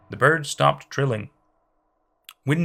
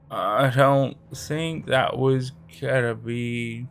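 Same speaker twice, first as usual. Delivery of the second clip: very slow, dazed drug-slurred speech; fuzzy confused voice; much slower than usual. Acted. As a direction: drag it out, stoned and lost. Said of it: "His voice still cracked".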